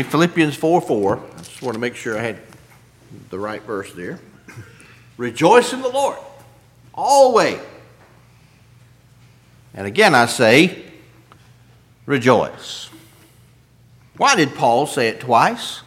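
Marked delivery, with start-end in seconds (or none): 5.2-12.9 reading scripture aloud. Acted. Preacher quoting the verse to his flock, proclaiming.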